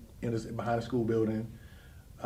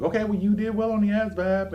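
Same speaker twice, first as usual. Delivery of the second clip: high, loud upbeat praise; chirpy salesman approval; recruiter voice; 10 semitones higher, 7 dB louder than usual. Retold or imitated. Recruiter praising her test score, high and upbeat.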